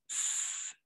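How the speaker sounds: a soft hiss, S with no voice in the throat; S whispered, no buzz; voiceless S. Shown as a demonstration. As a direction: hiss the S unvoiced like a plain S. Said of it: "S, comme un simple S, sans vibration".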